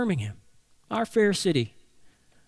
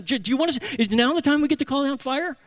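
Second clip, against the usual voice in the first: higher, eager giddy question; 7 semitones higher, faster than usual. Retold or imitated. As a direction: ask it like a kid who can't wait. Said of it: "There's a giddiness in their voice".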